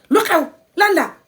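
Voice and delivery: sneering at mom with a high moody tone; rude moody. Shown as a demonstration. Child rudely answers mom, high and moody. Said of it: "not like".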